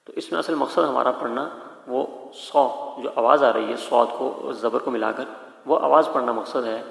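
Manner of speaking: normal talking voice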